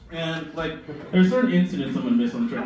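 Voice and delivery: ordinary talk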